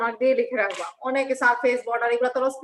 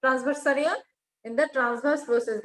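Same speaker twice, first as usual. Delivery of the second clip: slow dictation; much slower than usual. Shown as a demonstration. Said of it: "তোমাদের কাছে একটা খাতা কলম রাখবো".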